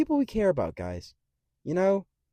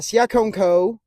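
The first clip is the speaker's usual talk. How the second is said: ordinary talk, nothing marked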